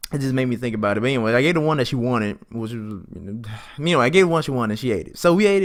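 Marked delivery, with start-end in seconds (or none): none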